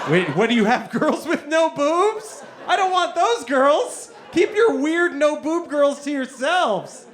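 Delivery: hammed-up indignant protest; mock indignation. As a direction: act baffled and offended. Mock outrage at no-boob girls.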